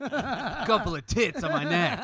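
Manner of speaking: high, slow laughing line. Retold or imitated. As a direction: giggle through it. Joking dad tattoo bit.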